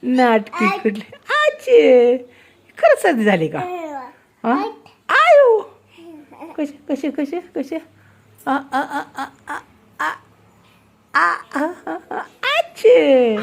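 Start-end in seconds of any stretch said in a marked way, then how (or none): 1.3-2.2 a put-on sneeze, loud; fake sneeze. Mother copying the girl's fake sneeze. 8.5-13.4 slow stuttering build into a sneeze; building mock sneeze. Mother acting out a sneeze for the girl.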